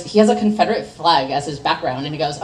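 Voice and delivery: usual speaking voice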